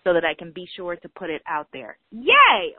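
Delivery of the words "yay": excited cheer. Real, not acted. Celebrating the circles launch.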